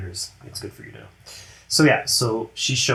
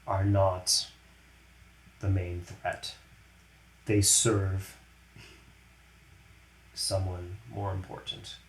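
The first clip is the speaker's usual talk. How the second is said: softer, slow, ominous reveal; quiet, solemn warning; grave Gamora; 2 semitones lower, 5 dB quieter than usual. Acted.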